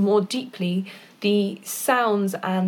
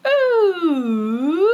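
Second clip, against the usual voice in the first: vocal siren warm-up; 8 semitones higher, 5 dB louder than usual. Shown as a demonstration. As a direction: swoop through the range like a siren. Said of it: "do some sirens, which is where you go".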